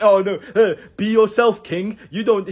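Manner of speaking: high, loud, sneering parody voice; mocking whiny voice. Mocking the be yourself king crowd in a high sneer. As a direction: squeal it like a preachy online guru. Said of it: "because it's so cool to say, like".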